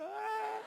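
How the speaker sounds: high sobbing wail; high cry out. Retold or imitated. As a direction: let out a high, breaking cry. Bursting into tears.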